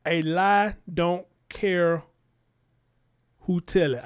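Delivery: slow, spaced out, pause before "who tell it"; slow and deliberate. Real, not acted. Vlogger restating a saying for viewers, slow and deliberate. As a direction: draw out "A lie don't care", then pause.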